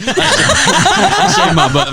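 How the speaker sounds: slow, high, laughing Sling Blade imitation. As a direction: drag it out slowly like Sling Blade. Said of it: "you said it like Sling Blade".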